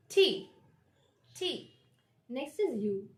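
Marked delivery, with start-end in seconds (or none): 0.1-1.7 T pitched higher, with a puff; crisp, falling T; aspirated T. Shown as a demonstration. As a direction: model letter name T. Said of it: "So the sound is between".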